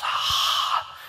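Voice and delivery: loud, open-mouthed voiceless exhale; breathy "hah" for a breath check; mimed breath out. Retold or imitated. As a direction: huff breath into someone's face. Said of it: "the girl goes".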